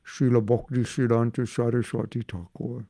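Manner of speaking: Bengali-accented name, mouth full; mild Bengali accent. Shown as a demonstration. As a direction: speak like a gulab jamun is in your mouth. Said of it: "that's how they speak".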